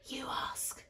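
hushed whisper between little friends; whispered nudge. A narrator's reading. Lola passes the asking to her friend, whispering. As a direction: whisper it so the others won't hear. Girls nudge each other secretly.

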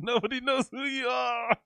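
high, laughing echo of a put-down; amused mimicry. Real, not acted. Streamer gleefully repeating the guest's insult, amused mimicry. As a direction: crow it high, fresh from laughing. Laughing, echoes the clip's line.

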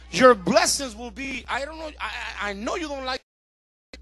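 usual speaking voice

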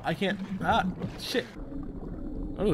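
ordinary talk

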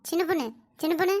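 low, soft hushed call; secret call. Acted. Neighbour quietly telling the daughter to follow her. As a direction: whisper her over without the mother-in-law hearing.

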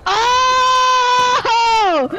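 long, high held "oh" cry. Real, not acted. Mock outcry over opponent's defeat.

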